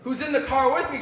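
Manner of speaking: ordinary talk, nothing marked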